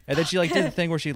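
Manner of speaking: ordinary talk, nothing marked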